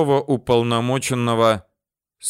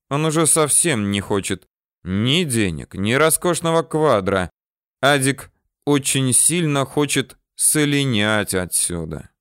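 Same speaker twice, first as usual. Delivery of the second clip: plain reading voice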